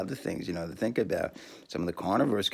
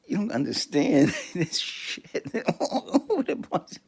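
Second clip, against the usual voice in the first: laughing; 11 semitones higher than usual. Real, not acted. Laughing through the punchline.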